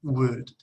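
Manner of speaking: Would said like "wood"; silent L. Shown as a demonstration. Teacher shows which letter is silent, Would pronounced as "wood". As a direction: model Would alone with the L left unsounded. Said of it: "La L no se pronuncia".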